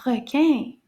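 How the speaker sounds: "Requin" run together as one quick word. Shown as a demonstration. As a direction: say "Requin" briskly, syllables joined. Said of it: "Let's say it a bit faster".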